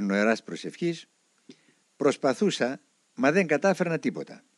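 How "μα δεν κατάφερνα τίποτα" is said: plain reading voice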